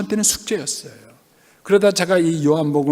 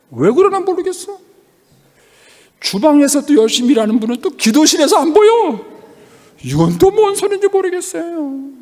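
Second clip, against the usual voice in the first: high, raised exasperated voice; baffled outburst; 9 semitones higher, 3 dB louder than usual. Real, not acted. Preacher telling church of his puzzle, exasperated. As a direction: cry out your bafflement, pitch pushed up.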